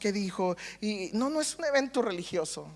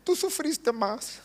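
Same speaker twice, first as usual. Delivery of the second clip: tearful prayer; slower than usual. Retold or imitated. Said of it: "Yo empecé a llorar".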